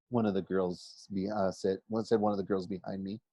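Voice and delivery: usual speaking voice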